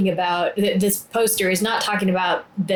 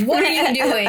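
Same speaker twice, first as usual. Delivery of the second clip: higher, indignant challenge; mock confrontation; 5 semitones higher than usual. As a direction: challenge them with mock outrage. Imagines confronting the neighbours.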